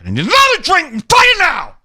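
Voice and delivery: high, loud, ending in a shout; scream. Retold or imitated. Man at a table ordering a drink, screaming. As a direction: blow up on the last words. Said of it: "emphasize the last word he says is a scream".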